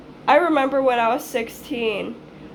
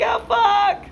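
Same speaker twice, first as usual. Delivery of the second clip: despairing; 12 semitones higher than usual. Retold or imitated. Daughter answers her scolding mom, high and despairing. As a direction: curse it like all is lost.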